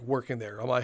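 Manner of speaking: ordinary talk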